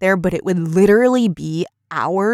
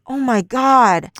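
slow, higher-pitched exasperated groan of words; retold exasperation; 5 semitones higher, much slower than usual. Daughter fed up with mom's arguing, exasperated. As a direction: drag it out, fed up.

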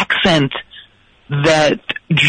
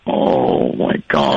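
exasperated groan; 12 semitones lower, much slower than usual. Real, not acted. Groaning at the pompous bit.

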